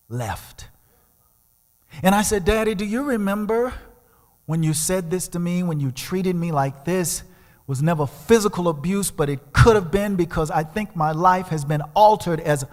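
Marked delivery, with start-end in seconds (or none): 2.4-12.6 pleading confrontation. Retold or imitated. Confronting father on phone.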